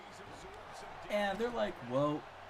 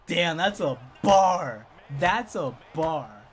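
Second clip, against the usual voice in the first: loud, stretched-out awe; hyped reaction; 3 semitones higher, 10 dB louder, much slower than usual. Retold or imitated. Boy reacts to friend's comeback at Natalia, loudly impressed. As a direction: holler it slowly like a hype man.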